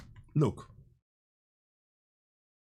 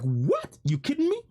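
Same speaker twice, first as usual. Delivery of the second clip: retold disbelief, pitch leaping up high; incredulous reaction; 8 semitones higher than usual. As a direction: act out a stunned double-take, rising sharply at the end. Reacting in disbelief to what he saw.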